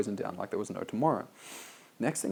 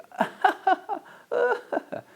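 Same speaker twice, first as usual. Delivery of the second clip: mocking laugh; 6 dB louder than usual.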